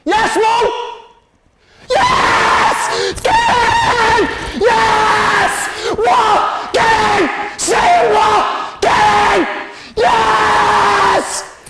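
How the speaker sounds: very high, full-throated screaming; ecstatic goal celebration. Real, not acted. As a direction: scream it like your team just scored.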